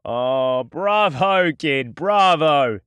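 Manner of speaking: slow, loud, drawn-out sarcastic bravo; mocking applause. A narrator's reading. Duran clapping at Jason's threat, slow sarcastic praise. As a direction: savour the mockery. Villain chuckles and claps mockingly.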